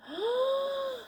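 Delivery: sharp high gasp. Acted. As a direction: gasp at the crash. Reader gasps at crash.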